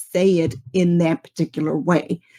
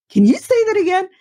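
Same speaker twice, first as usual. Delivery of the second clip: amazed ask; 11 semitones higher, much faster than usual. Retold or imitated. Retelling her own amazed reaction.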